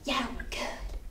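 soft unvoiced aside; whispered lyric. Shown as a demonstration. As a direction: whisper the line. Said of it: "be like".

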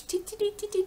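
high, quick little beeps; calculator tapping noises. Pretend. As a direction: imitate busy number punching. Imitates tapping calculator numbers.

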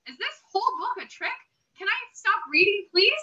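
ordinary delivery, nothing marked

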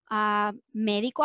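ordinary delivery

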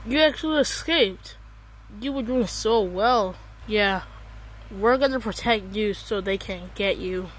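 usual speaking voice

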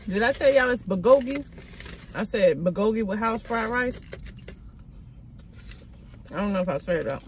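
eating between words; talking while chewing. Real, not acted. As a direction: mouth partly full of rice. Seen 3: Forkfuls of rice taken before and at 4.3s; lips closed chewing after.

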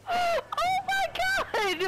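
high, drawn-out wail through laughter; real laughing meltdown, stretching the words; heboh panik. Real, not acted. Lag meltdown amid laughing fits.

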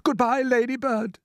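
high-pitched desperate goodbye; gasped farewell. A narrator's reading. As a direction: call goodbye as a boy facing death. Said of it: "gasped James".

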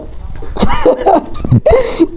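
long, squealing high laugh; high-pitched laughter. Real, not acted. Player teasing a friend, very high laughter. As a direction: burst into a high giggling fit.

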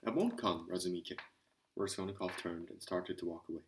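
plain reading voice

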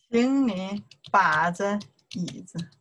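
measured, stretched syllables; careful word-by-word demonstration; slow model sentence. Shown as a demonstration. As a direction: say it slowly for a learner to repeat. Teacher repeats the model sentence.

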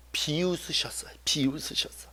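barely voiced, confiding whisper; whispered reveal to the listeners; hushed whisper. Real, not acted. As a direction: drop right down to a low whisper. Hushed reveal of God's reaction.

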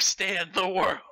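ordinary talk, nothing marked

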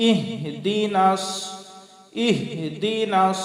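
slow "ইহদিনাস", breathed H after I; careful recitation. Shown as a demonstration. Teacher modelling the verse opening, slow careful recitation. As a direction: let H sound from the throat in "ইহদিনাস". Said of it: "কিভাবে তেলাওয়াত করি".

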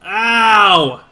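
loud drawn-out "ow"; loud pained yell. Pretend. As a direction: yelp as if hurt, long and loud. Hurt in game, cries out.

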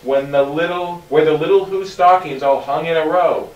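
plain reading voice

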